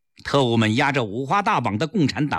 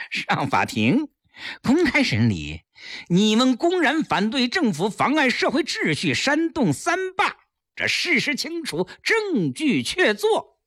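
scornful retort climbing high in pitch; sneer, then rise into high-pitched accusation; cold sneer; 11 semitones higher than usual. A narrator's reading. Captor scoffs at demand for trial, sneering. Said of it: "赖锦元冷笑道".

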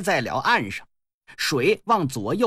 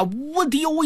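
high, swooping whizzing noise; imitated whoosh; 10 semitones higher than usual. A narrator's reading. Storyteller shows the ring thrown skyward, high whoosh. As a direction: voice the ring flying up, pitched high.

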